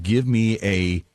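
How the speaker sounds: normal talking voice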